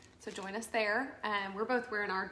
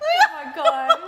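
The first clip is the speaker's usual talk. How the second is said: slow high laughing gasp; 12 dB louder, much slower than usual. Real, not acted. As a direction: drag it out, laughing. Laughing at lip.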